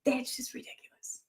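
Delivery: whispered scoff. Real, not acted. Mocking the post, she whispers a scoff. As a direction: scoff it under your breath.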